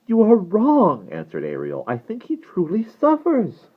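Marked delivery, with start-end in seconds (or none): 0.1-1.1 high, loud, slow contradiction; high, earnest girl's voice; insistent girl. A narrator's reading. Ariel contradicts the Duro. 1.9-3.7 put-on high, slow, pitying girl's voice; high-pitched, drawn-out concern; earnest pleading. A narrator's reading. Ariel pleads for the vampire.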